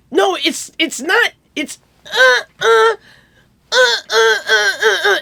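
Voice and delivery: high, halting protest into grunts; imitated producer objecting. Producer explaining the song to the duo, high grunting imitation. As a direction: grunt out the feel in mock frustration.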